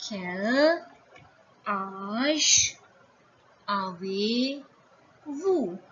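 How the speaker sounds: slow "Quel ... âge ... avez ... vous"; word by word. Shown as a demonstration. Teacher introducing the formal age question, slowly word by word. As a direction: stretch each word with a gap after. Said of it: "you will say".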